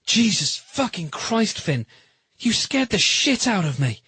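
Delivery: high, shaken outburst, drawn out; frightened relief spilling out, pitched high; shaky voice. A narrator's reading. Adam scolding Finn after a near drowning, shaky. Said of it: "His voice was shaky".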